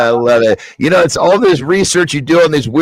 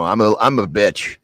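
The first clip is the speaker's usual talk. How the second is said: ordinary delivery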